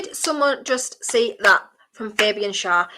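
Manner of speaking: ordinary talk, nothing marked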